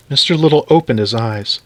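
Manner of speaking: ordinary delivery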